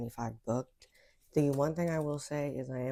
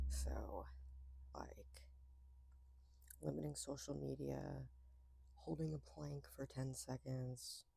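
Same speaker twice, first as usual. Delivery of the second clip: soft hushed voice; whispering; 10 dB quieter than usual. Real, not acted. Talking softly, hushed.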